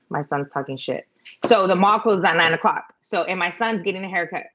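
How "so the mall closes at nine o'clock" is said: ordinary talk, nothing marked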